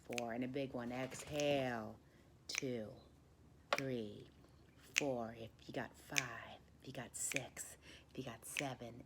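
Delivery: guided meditation. Acted. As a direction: coax the last air out gently. Counts a slow breath, whispers.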